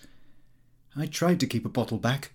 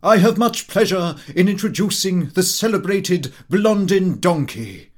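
loud, higher ringmaster call; booming higher showman introduction; showman announcer; 6 semitones higher, 8 dB louder than usual. A narrator's reading. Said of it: "Watson then said".

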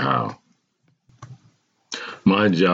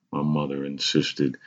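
plain reading voice

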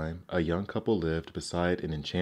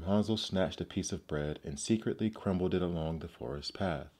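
ordinary delivery

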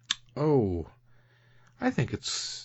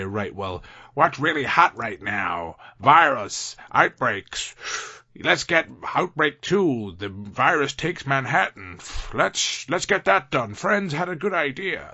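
higher, louder hyped Hollywood producer; movie producer voice; 4 semitones higher, 3 dB louder than usual. Retold or imitated. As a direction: pitch it like an eager studio exec. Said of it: "that's my best kind of movie producer voice".

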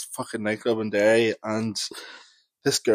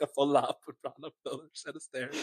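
usual speaking voice

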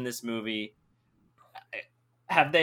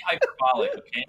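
laughing; 9 semitones higher, faster than usual. Real, not acted. Laughing while ranting.

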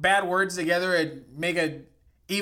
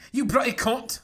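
badly faked British accent; 6 semitones higher than usual. Shown as a demonstration. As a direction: have another go at mock-British. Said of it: "I'm saying in a British accent".

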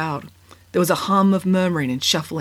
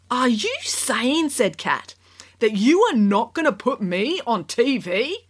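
put-on disbelieving girl voice; 6 semitones higher, slower than usual. A narrator's reading. Kat disbelieving.

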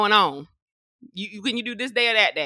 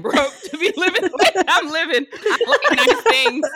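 laughing high; 11 semitones higher, 6 dB louder than usual. Real, not acted. Former teacher joking about liking nice things, laughing high.

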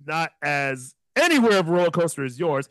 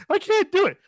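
high, whiny wife voice, much higher pitch; panicked wife; 13 semitones higher than usual. Retold or imitated.

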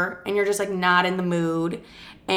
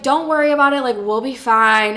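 breezy reassurance; 4 semitones higher, 5 dB louder than usual. Retold or imitated. Friend reassuring her in the bathroom.

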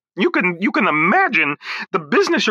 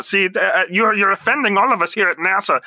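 ordinary talk, nothing marked

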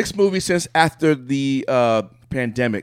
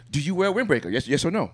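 normal talking voice

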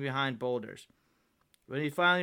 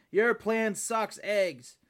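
slow, high, drawn-out put-down; emphatic word-by-word jeer; blunt insult; 7 semitones higher, 3 dB louder, much slower than usual. A narrator's reading. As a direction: stretch out every word with scorn. Frosty bluntly insults the plan.

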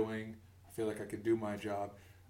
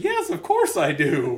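louder, laughing mock agreement; laughing sarcasm; 5 semitones higher, 10 dB louder than usual. Answering nosy coworkers, laughing sarcastic reply.

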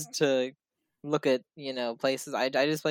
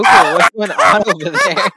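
laughing; 6 semitones higher, 13 dB louder than usual. Real, not acted. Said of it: "It's like the Joker".

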